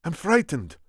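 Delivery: high-pitched reply in character; alarmed Spaniard. A narrator's reading. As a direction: answer uneasily as the Spaniard. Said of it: "was the Spaniard's reply".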